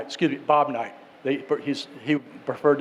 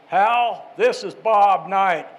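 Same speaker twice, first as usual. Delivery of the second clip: higher, slow, deliberate coach voice; Bobby Knight imitation; 3 semitones higher, much slower than usual. Prank caller greets radio host, slow Bob Knight impression. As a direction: introduce yourself like the famous coach, stretched out. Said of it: "that's a pretty good Bobby Knight imitation".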